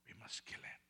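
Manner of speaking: whispered threat; conspiratorial whisper. Acted. Plotters whispering their plan to kill the preacher. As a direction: whisper it like a secret plot.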